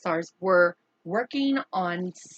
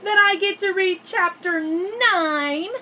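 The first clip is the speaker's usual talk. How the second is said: bubbly story-time hello; excited greeting; 10 semitones higher, 6 dB louder than usual. Real, not acted. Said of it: "so I am so excited".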